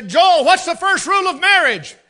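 high, loud drill-sergeant question; loud quizzing call. Retold or imitated. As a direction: fire the question at him on speakerphone. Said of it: "I'd say".

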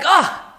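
short high sighing groan, demonstrated; demo groan. Shown as a demonstration. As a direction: let out a Yiddish krechts groan. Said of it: "a krechts, like".

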